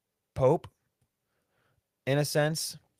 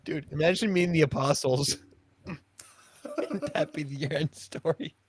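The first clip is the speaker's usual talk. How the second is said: normal talking voice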